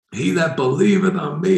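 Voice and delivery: high, held-back proclaiming cry. Acted. Preacher acts Jesus proclaiming at the feast, crying out. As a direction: call it out high, as if to a crowd, without full volume. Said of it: "crying with all his voice".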